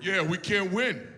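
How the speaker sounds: lightly voiced self-pitying grapefruit; bad grapefruit impression. Grapefruit feeling sorry for itself, lightly put-on voice. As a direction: complain on behalf of grapefruits, in a slight put-on voice. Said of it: "the worst impression of a grapefruit".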